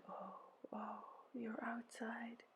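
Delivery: very hushed, slow moan; soft echoed moan. A narrator's reading. Helpers echo Ereshkigal's pain, whispery sympathetic moan. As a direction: moan it back almost in a whisper. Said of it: "They moaned".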